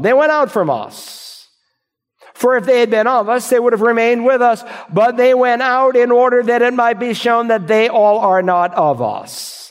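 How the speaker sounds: a pastor pressing the verse on his flock, rising to full preaching voice; preaching at full force. Acted. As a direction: drive the verse home from the pulpit.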